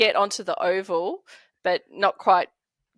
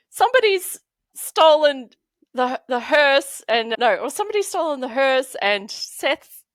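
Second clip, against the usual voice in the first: higher, louder, tripping over the words in a panic; flustered panic; 6 semitones higher, 5 dB louder than usual. Retold or imitated. Calling the cops after the hearse is stolen, flustered and panicky. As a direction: stumble and restart, too rattled to get it out straight. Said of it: "I couldn't get the words out".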